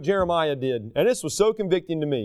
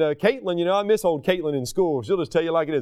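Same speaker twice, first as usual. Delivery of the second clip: ordinary talk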